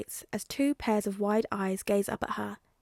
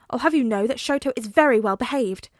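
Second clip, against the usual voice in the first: cool, clipped indignation, a touch louder; frosty; 7 dB louder than usual. A narrator's reading. Offended mother answers the CEO, frosty. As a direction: draw yourself up and put him in his place. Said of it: "in a frosty tone declares".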